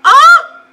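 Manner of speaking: high, loud "ah" shooting upward; shocked gasp. Real, not acted. Viewer shocked by the film, sharp "ah". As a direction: cry out in sudden shock.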